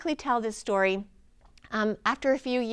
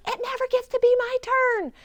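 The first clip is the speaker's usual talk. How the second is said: much higher and louder, a complaining little organ; whiny organ voice; 13 semitones higher, 5 dB louder than usual. Retold or imitated. Thyroid organ complaining to the healer, high whiny voice. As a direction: play a thyroid whining it never gets a turn. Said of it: "I can hear people's organs talking to me".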